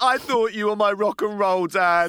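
mock disappointed child. Daughter mocks dad's soppy waltz, slow teasing whine. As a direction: play the let-down daughter, drawn out. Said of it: "She's probably like".

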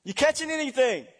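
high, loud call across the water; shouting from the shore. Acted. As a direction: holler from the beach to the boat. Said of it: "He hollers out".

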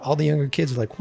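usual speaking voice

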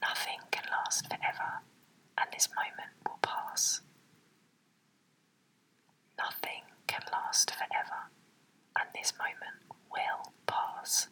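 whispered under the breath. Shown as a demonstration. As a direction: whisper it to yourself. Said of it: "Say that to yourself".

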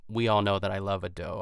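usual speaking voice

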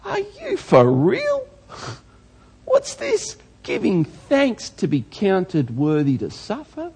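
high, slow, disbelieving squeal; mock astonishment at such thanks; incredulous. Preacher voicing his own disbelief, high and incredulous. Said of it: "I look at that and going".

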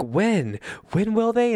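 mock breathless curiosity; eager questions. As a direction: ask it like a hanging-on-every-word fan. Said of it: "and we're like".

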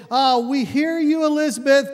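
smooth her over before checking with Dad; placating relatives. Retold or imitated. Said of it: "they said".

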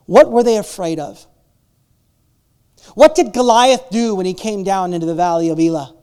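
usual speaking voice

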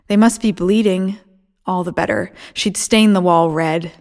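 plain reading voice

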